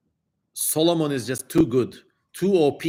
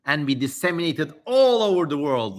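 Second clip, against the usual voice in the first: raised, declaiming scripture voice; solemn reading; 5 semitones higher, 5 dB louder than usual. Acted. Streamer reading the demon's prophecy to chat, solemn declaiming. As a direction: read it like an old holy text. Reads ancient demon text aloud.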